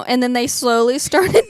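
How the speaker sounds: normal talking voice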